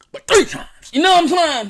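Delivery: put-on proud country-boy act. Shown as a demonstration. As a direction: pitch jumps up out of the meek voice. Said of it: "do just like this".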